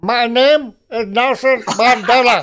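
slow, deliberate Mandela voice; Nelson Mandela impression. Mate doing Nelson Mandela for the pundits, slow and deliberate. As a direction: mimic Mandela slowly. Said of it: "I can do Nelson Mandela".

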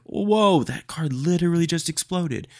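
amazed, mock-shocked cry; lightly acted shock at the car. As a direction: act stunned by the explosion. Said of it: "you'd be like".

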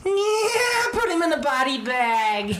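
very high, squealed jeer; a high-pitched, gleeful villain-sidekick cry; Karate Kid crony impression. Co-host doing Karate Kid's jeering crony, high squeal. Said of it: "Can I come and do Karate Kid".

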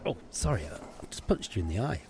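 low, soft sorry; muted apology. Acted. Host to the customer just punched, quiet apology. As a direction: apologise quietly, almost under your breath.

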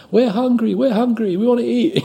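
high-pitched cheerleader clamoring; mock cheerleaders. Busload of cheerleaders coming in hungry, mock clamoring. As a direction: chant it high like eager cheerleaders.

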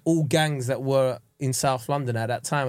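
normal talking voice

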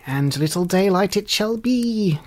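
creaky, high wicked fairy; dry-axle fairy cry. A narrator's reading. Wicked fairy pronounces on the baby, creaky high cry. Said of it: "cried the fairy in the tone of a dry axle".

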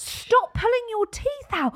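dragged-out horrified yell; mock panic. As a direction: beg her to stop in mock horror. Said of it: "And I was like".

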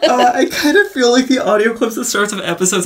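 high, loud talk through laughter; laughing. Real, not acted. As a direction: still cracking up while talking. Cracking up after the yelled quote.

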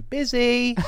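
a jokey soundboard sound bite brushing off an admirer; soundboard clip. Pretend. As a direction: fire it off like a pressed button. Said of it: "I just use a soundboard now".

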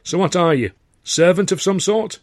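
put-on high-pitched question in a broad northern English accent; thick regional accent. A narrator's reading. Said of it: "a voice with a thick sort of accent".

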